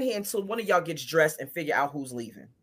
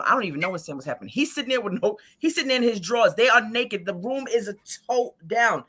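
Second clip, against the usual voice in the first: ordinary talk, nothing marked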